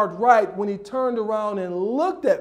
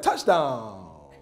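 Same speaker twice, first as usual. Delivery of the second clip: drawn-out touchdown call, falling away; stretched-out scoreboard announcement; announcer call; 8 semitones lower than usual. Retold or imitated. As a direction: lightly mimic a stadium announcer calling a score. Mimics announcer calling scores.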